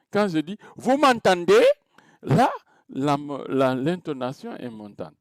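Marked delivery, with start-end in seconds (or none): 0.8-1.6 higher, louder, climbing on "Vous m'entendez?"; rising question intonation. Shown as a demonstration. Teacher showing students an interrogative sentence, rising intonation on "Vous m'entendez?". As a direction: ask "Vous m'entendez?" with the pitch going up, projected. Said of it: "mon intonation est montante".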